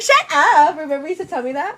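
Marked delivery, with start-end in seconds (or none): 0.0-0.8 higher, louder playful shut-down; mock indignant retort. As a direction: snap back at a teasing partner, half joking. Acted retort to teasing partner.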